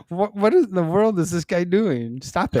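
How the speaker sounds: baffled and annoyed; exasperated. Retold or imitated. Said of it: "I'm just like".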